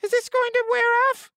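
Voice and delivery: high-pitched helium voice. Acted. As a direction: talk like you just inhaled helium. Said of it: "I sound like a, a weird, sick Muppet".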